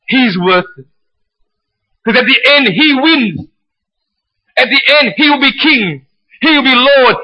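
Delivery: high, emphatic sermon delivery; impassioned preaching. Real, not acted. Preacher urging the congregation on to the prize, impassioned preaching. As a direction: preach it with rising conviction. Preaches at rising full force.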